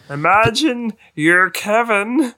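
high, slow mocking tone; mocking quote. Friend mocking his running tip, high and slow. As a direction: tease with a high put-on voice. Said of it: "It's like".

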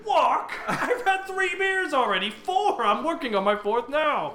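high-pitched, protesting distress. Acted. Tipsy uncle told he must walk to the forest, distressed. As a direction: wail it in hammed-up distress, voice shooting up. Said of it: "very distressed".

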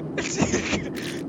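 high evil cackle. Acted. Friend betrays the others and flies alone to Mallorca, evil cackle. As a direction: cackle like a traitor flying off alone. Villain laugh after betrayal.

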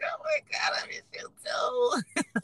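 very high, drawn-out mock whine; mock swooning. Woman answering a man who misses her, swooning. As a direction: melt into a tiny high squeal. Melting at a man's sweet words.

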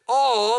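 all punched loud and drawn out; stress on all. Shown as a demonstration. Pastor urging the congregation, punching all. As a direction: hit the word all hard. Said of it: "Everybody say all".

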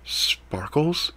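soft, incredulous rising question. A narrator's reading. Ethan doubting the cat's name, soft and incredulous. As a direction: repeat it in disbelief.

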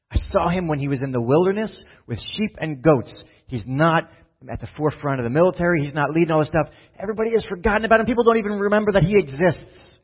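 building from firm to high, fast and loud; rising passion as God defends his choice; fervent God voice. Acted. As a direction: divine voice climbing to a fever pitch.

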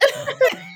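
a high burst of giggling; high giggle. Real, not acted. Laughing at going off on a tangent.

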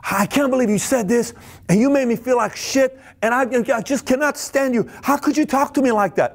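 high, upset accusing voice; mock hurt partner, pitched high. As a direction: whine it out, hurt and indignant. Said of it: "this is what they say".

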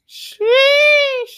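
very high, loud excited cry; high squealed sheesh. Real, not acted. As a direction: squeal it out in mock amazement. Hype reaction to girlfriend wallpaper.

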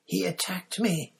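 high, aggrieved character voice; dazed victim accusing his attacker, pitched well up; muttering injured man. A narrator's reading. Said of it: "Krum muttered".

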